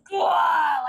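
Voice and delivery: tidal-wave blurt, pitched high; aggressive mock roar. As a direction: burst out like a crashing wave, imitating someone pushy. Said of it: "you are more aggressive ... massive tidal wave".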